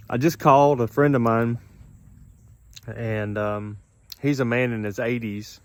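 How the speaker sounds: ordinary talk, nothing marked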